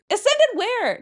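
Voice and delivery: high disbelieving question; incredulous. Real, not acted. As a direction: ask it baffled.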